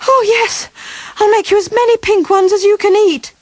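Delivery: high, eager promise; relieved boy eagerly promising the beast cakes, pitched high; eager cry. Acted. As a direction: rush to please the beast. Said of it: "cried Sam".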